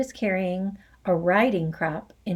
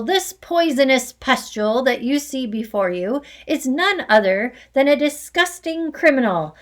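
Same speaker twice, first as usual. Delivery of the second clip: furious rant; 2 semitones higher, 5 dB louder than usual. Acted. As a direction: spit out each insult with contempt. Headmistress ranting insults.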